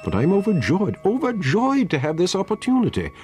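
high-pitched, gushing welcome; flustered, over-eager pleasure; effusive delight. A narrator's reading. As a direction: overdo the joy at meeting them. Doctor feigning delight at unwelcome meeting.

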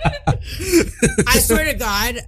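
slow, laughing through the words. Real, not acted. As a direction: say it while cracking up. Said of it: "laughing while speaking".

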